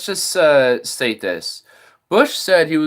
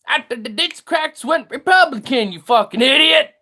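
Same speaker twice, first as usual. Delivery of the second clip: angry sarcastic rant; 11 semitones higher than usual. Real, not acted. Ranting sarcastically at caller.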